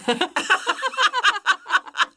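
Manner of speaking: high, loud burst of laughter; real laughter. Real, not acted. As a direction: crack up laughing. Cracks up at friend's remark.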